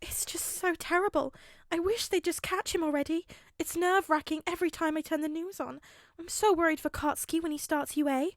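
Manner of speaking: high, fretful worried mother; fretting mother. A narrator's reading. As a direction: wring your hands over it in your voice. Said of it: "Inko fretted".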